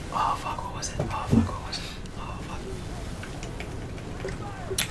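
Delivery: muttered under the breath, searching memory; quiet frustrated whisper to self; whispered to himself. Real, not acted. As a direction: whisper it to yourself while trying to recall. Straining to remember, muttering.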